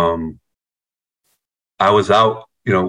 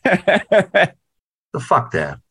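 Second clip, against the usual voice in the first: drawn-out scoff; 8 semitones higher, much slower than usual. Retold or imitated. Mock refusal of dad's advice.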